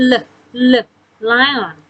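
l l sounded before lion; teacher sounding out the l sound; phonics sound. Shown as a demonstration. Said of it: "ยกตัวอย่าง อันนี้ l".

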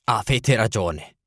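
plain reading voice

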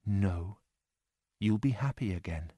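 soft, gentle inner voice; a tender inner voice consoling; sweetly. A narrator's reading. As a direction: comfort yourself softly. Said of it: "my heart answered sweetly".

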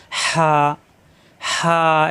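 হা with a strong rasp of air from mid-throat, then a held vowel; hot-air throat sound. Shown as a demonstration. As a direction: squeeze mid-throat and push হা out on warm air. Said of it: "একটু টানা যায়". (গলার মাঝখান থেকে গরম বাতাসে টানা হা)

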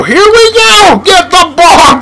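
clutch-time hype; hyped shout. Real, not acted. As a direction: pump up for the final play. Final seconds of the game.

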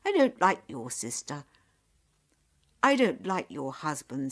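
acted anger, forceful and loud; angry. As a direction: let them hear how offended you are. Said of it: "Nyap said angrily".

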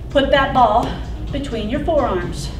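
ordinary talk

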